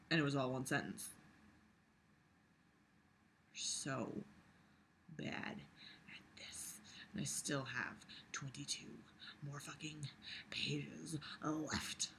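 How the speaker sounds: soft, half-whispered despair; soft defeated whisper; defeated mumble. Real, not acted. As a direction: mutter it to yourself, drained. Despairing aside to self.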